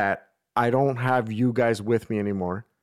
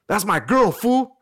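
mock confrontational shout, high and loud; angry boyfriend; 9 semitones higher, 5 dB louder, slower than usual. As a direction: imitate a jealous guy squaring up. Acting the angry boyfriend confronting him.

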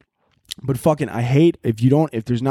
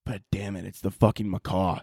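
soft, lower letdown; deflated mutter; 4 semitones lower, 8 dB quieter than usual. Retold or imitated. Homeowner finding it was only the macaw, soft deflated. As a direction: sag with relief and annoyance. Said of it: "I'd be like".

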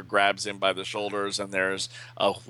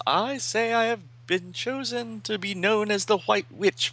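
high, solemn heroine voice; 13 semitones higher than usual. Acted. Misa declaring herself to her teachers, high voiced. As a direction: lift the pitch high for her proud declaration. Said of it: "And Misa says".

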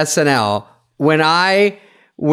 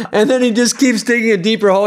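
ordinary delivery

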